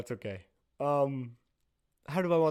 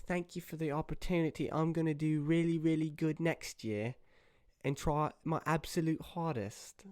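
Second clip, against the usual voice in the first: higher, softer British lady voice; British accent; 4 semitones higher, 5 dB quieter than usual. Acted. British bear thanking the judge, soft British accent. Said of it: "maybe she's gonna be British".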